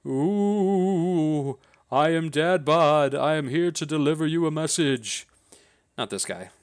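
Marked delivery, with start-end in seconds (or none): 0.1-5.3 slow, eerie ghost voice. Acted. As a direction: moan it like a ghost. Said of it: "says the ghost".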